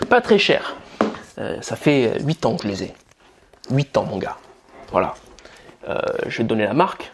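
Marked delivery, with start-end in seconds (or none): 0.1-0.8 jokey Moroccan accent, pitched up; higher-pitched Moroccan-accented sales line. Shown as a demonstration. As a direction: put on a playful Moroccan accent. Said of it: "je fais mon accent marocain".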